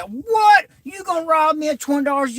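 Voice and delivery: shouting high and fast in disbelief; outraged shout. Retold or imitated. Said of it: "So then I said".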